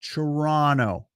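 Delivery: slow demonstration of Toronto as "tuh-RAH-no"; Toronto with the second T silent; local pronunciation. Shown as a demonstration. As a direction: say Toronto slowly, dropping the last T. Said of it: "only one T in Toronto that".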